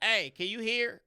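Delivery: loud, high taunting call at a deaf passerby; teenage brat hollering down the street; taunting yell. Retold or imitated. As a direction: holler it out to mock him. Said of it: "be like".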